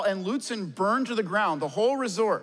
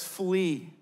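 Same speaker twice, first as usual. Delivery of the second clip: normal talking voice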